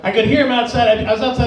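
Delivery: ordinary talk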